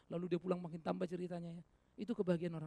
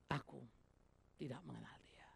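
hushed whisper, voice dropped away; whispered denial; 3 semitones lower, 11 dB quieter than usual. Acted. Peter denying his master, whispered softly. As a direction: breathe it out secretly, afraid to be heard.

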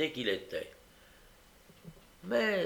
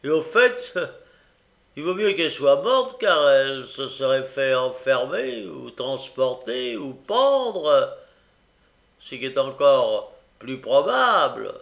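méchanceté insultante; 7 dB louder than usual. A narrator's reading. Noé poursuit l'insulte.

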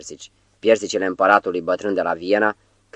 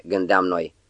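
ordinary delivery

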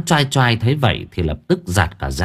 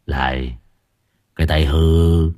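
mocking; 6 semitones lower, slower than usual. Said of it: "Giọng nói mỉa mai". (giọng trầm, mỉa mai khẽ khàng)

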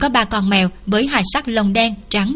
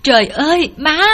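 higher-pitched cry for mother; narrated shriek, moderately raised; mild acted scream; 6 semitones higher, 3 dB louder than usual. A narrator's reading. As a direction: cry out in alarm, short of screaming. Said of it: "phải kêu thét lên".

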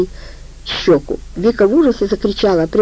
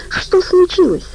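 alarmed question; 12 semitones higher, slower than usual. Acted. Vika asks in alarm.

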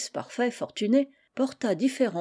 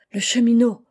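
a loud startled cry of recognition; startled exclamation; 3 semitones higher, 7 dB louder than usual. A narrator's reading. Sister recognizing the railwayman at dinner, startled loud cry. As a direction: blurt the name out in shock, louder. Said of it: "l'exclamation de sa sœur".

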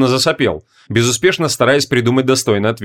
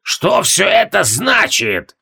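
high slow shouted demand; furious shout; 7 semitones higher, much slower than usual. A narrator's reading. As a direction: roar it up at the walls. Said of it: "прогремело снизу".

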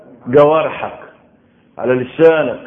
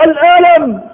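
pained cry; 11 semitones higher, 8 dB louder than usual. Retold or imitated. Struck man explains his pain.

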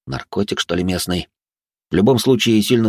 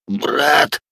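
choked wheeze, higher; wheezed; 8 semitones higher than usual. Acted. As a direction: wheeze it out, choking on meat. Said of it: "поперхнувшись куском мяса, просипел Василь".

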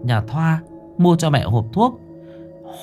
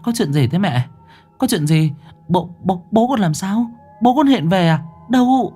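alarmed daughter; 9 semitones higher than usual. Acted. Daughter alarmed, rushing to mother.

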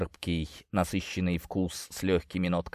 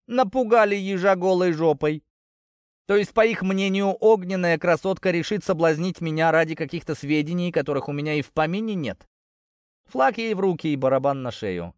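mocking scoff; 7 semitones higher than usual. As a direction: laugh the threat off. Hero mocking the warning.

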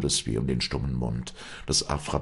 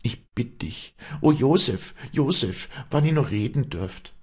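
high, agitated, imploring outcry; crying out; 11 semitones higher, 5 dB louder than usual. A narrator's reading. Afra dismayed, appealing to unconscious Josef, crying out. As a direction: cry out helplessly in a raised pitch. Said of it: "rief Afra".

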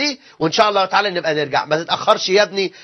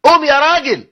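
higher, louder sharp call of قوم; mother-in-law yelling; 7 semitones higher, 5 dB louder than usual. Retold or imitated. Wife ordering her husband to get up, loud yell. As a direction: bark قوم يا راجل at the husband. زلزال سبعتاشر ريختر.

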